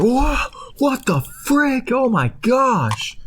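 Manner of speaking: very high, slow stunned gasp; horrified disbelief at the skinning; shocked groceries. Retold or imitated. The groceries watch Potato skinned, very high shock. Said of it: "they're just like".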